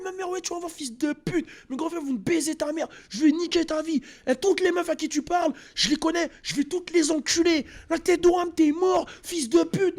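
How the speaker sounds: hammed-up enraged girlfriend yelling; furious yelling girlfriend. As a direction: shriek it at him like a jilted girlfriend. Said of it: "Elle criait".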